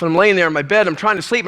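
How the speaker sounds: ordinary talk, nothing marked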